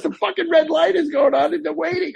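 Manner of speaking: hammed-up assistant's impatience; mock scolding assistant. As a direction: scold him like he's holding up the set.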